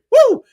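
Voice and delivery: sharp high whoop of cheer; high, loud cheering woo; excited woo. Real, not acted. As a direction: let out a big celebratory whoop. Host cheering a chat message.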